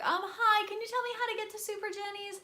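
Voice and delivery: high polite voice asking strangers for directions; sweet lost tourist. Retold or imitated. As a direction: put on a small bright voice asking directions. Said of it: "and I was like".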